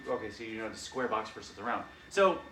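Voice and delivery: ordinary delivery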